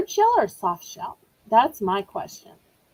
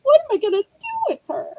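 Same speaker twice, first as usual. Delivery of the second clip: imitating Rainbow; 9 semitones higher than usual. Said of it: "Rainbow's probably like".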